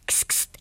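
hissing kst whisper. Acted. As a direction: hiss softly to get attention. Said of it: "ertönte ein Stimmchen".

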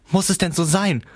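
high-pitched anguished question; pleading call. A narrator's reading. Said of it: "rief sie".

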